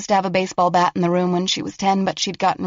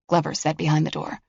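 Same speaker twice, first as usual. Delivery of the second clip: ordinary delivery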